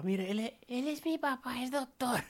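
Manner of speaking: put-on high little kid voice; child voice. Boy introduces his father to the injured man, high child voice. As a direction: proudly present his dad like a small boy.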